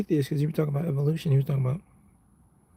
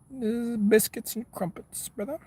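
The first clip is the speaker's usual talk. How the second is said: mock British accent; 8 semitones higher than usual. Streamer ribbing a British chatter about accents, mock British accent.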